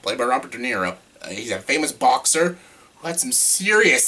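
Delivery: normal talking voice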